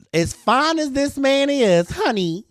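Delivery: high, loud, slow diva delivery; sassy rant. Acted. Teasing viewers about a handsome AI model, high sassy diva. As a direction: lay it on thick, honey.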